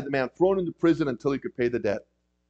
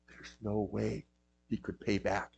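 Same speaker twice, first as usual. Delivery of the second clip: hushed; 6 semitones lower, 12 dB quieter than usual. Real, not acted. Stressing the impossible debt.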